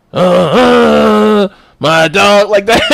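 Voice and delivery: hammed-up [name] hype-man bark; [name] impression. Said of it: "D M X ... some dude who was like".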